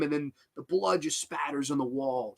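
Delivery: normal talking voice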